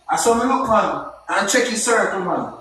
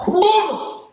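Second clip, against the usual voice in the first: high-pitched drawn-out boom; sudden high boom sound; mimicked impact boom; 13 semitones higher than usual. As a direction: voice the punch landing as a sound effect. Acts out the blow in his story.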